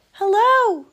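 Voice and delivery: loud high call across water. Acted. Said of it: "he called".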